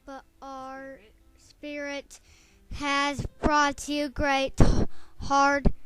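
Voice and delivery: loud, slow, reassuring frog voice; the frog comforting the weeping mouse; comforting Magic Frog. Acted. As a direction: soothe the crying mouse. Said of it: "said Magic Frog".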